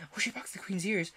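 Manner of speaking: unvoiced conspiratorial whisper; whispered gossip. A narrator's reading. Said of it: "the Rabbit began".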